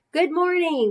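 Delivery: put-on high chorus of kids calling back; class shouting back. A narrator's reading. Kids answering their teacher, high and bright. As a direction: call it out like a whole class.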